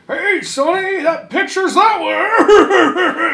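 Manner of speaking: put-on high, slow, laughing old-man holler; cranky geezer scolding a young man; crusty old man. Crusty old man heckling the kid, high slow holler. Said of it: "them old crusty fucks just".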